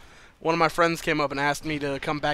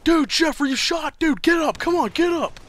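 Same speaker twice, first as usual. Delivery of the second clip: much higher, loud urgent calling; urgent friend's voice; 12 semitones higher, 4 dB louder than usual. Retold or imitated. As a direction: shake him awake, pitched way up and urgent. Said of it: "I hear Mark's voice".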